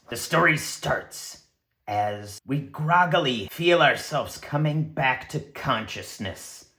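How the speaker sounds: usual speaking voice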